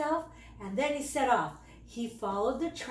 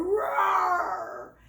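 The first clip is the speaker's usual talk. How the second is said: shouted cub roar, higher; lion roar; 6 semitones higher, 3 dB louder than usual. A narrator's reading. Brave cub roaring at the snake to drive it away. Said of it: "brave Bruno roared".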